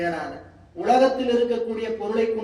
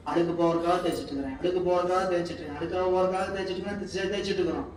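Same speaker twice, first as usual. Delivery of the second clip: fast repetitive scrubbing; 3 semitones lower, much faster than usual. Shown as a demonstration. Mimics scrubbing over and over.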